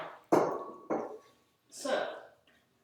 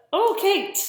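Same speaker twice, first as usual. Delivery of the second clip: delighted surprise; 9 semitones higher, 9 dB louder than usual. Real, not acted. Spots a friend joining the stream.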